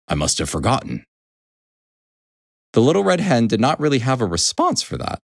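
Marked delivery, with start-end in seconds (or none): 0.1-1.1 low, deadpan horse; flat delivery. A narrator's reading. Mister Horse to the hen, low flat reply. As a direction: state it low with little feeling. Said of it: "Mister Horse said flatly".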